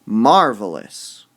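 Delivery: Marvelous slow and loud, MAR punched, falling; stress on MAR. Shown as a demonstration. Teacher models Marvelous with the mar part emphasized. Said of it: "We emphasize the mar part".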